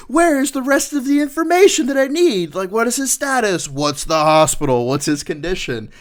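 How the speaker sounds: high, loud panicked demands; frantic boss demanding answers; alarmed sergeant major. Retold or imitated. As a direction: fire off the questions in a high alarmed voice. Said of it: "And he's like".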